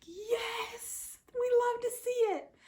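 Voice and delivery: high, loud triumphant yes; cheering the mother's revenge; gleeful cheer. Retold or imitated. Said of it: "I was like".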